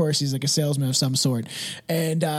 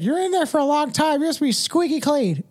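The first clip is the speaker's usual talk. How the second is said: much higher sing-song mock child; imagined kid teasing dad in the shower, much higher; 9 semitones higher than usual. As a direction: tease like a cheeky little kid.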